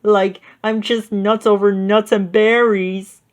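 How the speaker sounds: high, delighted Shaggy; Shaggy's voice. A narrator's reading. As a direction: savor it, hungry. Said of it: "Shaggy rubbed his belly".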